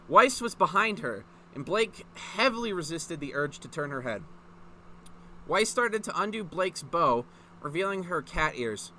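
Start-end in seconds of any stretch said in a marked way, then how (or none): none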